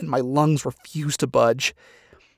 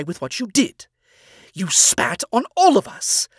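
higher, stern scolding father listing faults; authoritative tone; 4 semitones higher, 4 dB louder than usual. Acted. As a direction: lay down the verdict, firm and rising. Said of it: "newfound authoritative tone".

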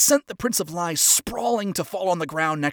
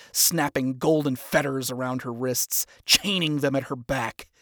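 plain reading voice

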